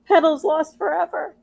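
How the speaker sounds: put-on a little girl sure her doll is gone forever; panicked wail. Child panics in story.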